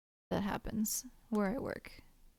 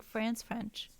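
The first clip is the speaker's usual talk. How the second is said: usual speaking voice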